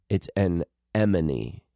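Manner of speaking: slow "an emone", M sound first; correct pronunciation. Shown as a demonstration. Settling the name for co-host, slow correct pronunciation. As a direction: draw out "an emone" as two careful words. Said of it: "It's not an enemy".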